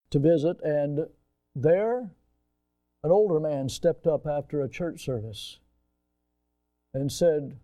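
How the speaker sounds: ordinary delivery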